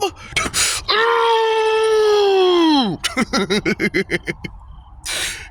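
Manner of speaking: a long, high laugh that breaks into a cry-shout; high wailing laugh. Real, not acted. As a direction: howl with laughter, half crying. Laughing at being Madam Web's mother.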